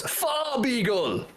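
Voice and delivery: high, drawn-out crowing of a silly name; gleeful announcement. Shown as a demonstration. Friend revealing a German Pokemon name, gleeful. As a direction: crow it, stretching the word right out.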